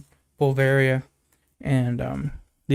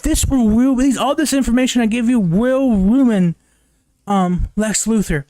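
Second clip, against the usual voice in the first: put-on higher, louder, urgent ex-girlfriend voice; urgent warning; 8 semitones higher, 5 dB louder than usual. Ex-girlfriend warns Jimmy about Lex, high urgent voice. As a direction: press the warning on him, high and loud. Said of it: "'cause she says".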